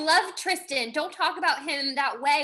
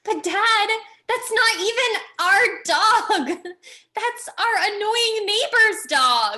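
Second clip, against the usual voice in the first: laughing protest; 6 semitones higher, 4 dB louder than usual. Acted.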